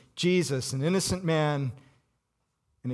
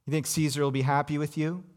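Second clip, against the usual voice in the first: usual speaking voice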